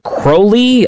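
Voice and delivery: Crowley with a long O, as a high-pitched question; alternative pronunciation. Shown as a demonstration. Host asking about the name, questioning long-O Crowley. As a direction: say Crowley like "crow-lee", questioning. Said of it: "how's the correct way to pronounce".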